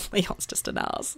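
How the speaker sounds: slow, breathy whispered jab; conspiratorial whisper aside. Real, not acted. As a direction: hush it like a secret insult. Aside about husband.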